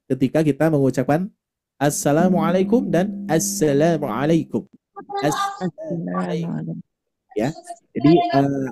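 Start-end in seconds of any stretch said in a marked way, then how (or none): 1.8-4.7 "assalamualaikum" said first the plain Indonesian way, then slower with a doubled s and Arabic vowels; two ways of assalamualaikum. Shown as a demonstration. Teacher showing parents the accent difference in a greeting. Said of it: "aksennya beda". 5.2-6.8 "Assalamualaikum" slow and soft with a held doubled s; Arabic-style greeting. Shown as a demonstration. Teacher modelling the greeting the Arabic way, slow and soft. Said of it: "aksennya beda".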